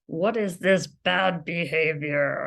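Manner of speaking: slow, measured imitation of a parent's reproach; scolding parent. Parent speaking to their child, slow scolding. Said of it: "a parent that's saying".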